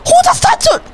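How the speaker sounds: very high squealed question; shocked disbelief. Real, not acted. Player asks if baby ate it all alone, squealing in shock. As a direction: squeal it, aghast.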